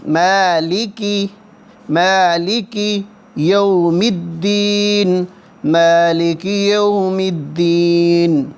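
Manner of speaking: drawn-out chanting, الرحمن held long; Quranic recitation. Shown as a demonstration. Teacher repeating verses for students, slow recitation. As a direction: recite slowly, stretching each long vowel.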